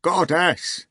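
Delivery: high hog howl. Acted. The hog howling to Natasha, high. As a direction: howl it pleading. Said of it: "howled the hog".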